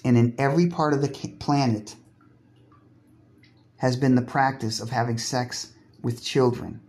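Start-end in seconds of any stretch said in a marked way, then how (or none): none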